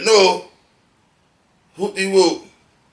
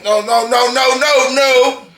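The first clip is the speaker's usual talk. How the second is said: building from firm to much louder and higher; each no rising over the last; escalating loud no, no, no; emphatic refusal; 8 semitones higher, 6 dB louder, slower than usual. Real, not acted. Refusing his partner's control.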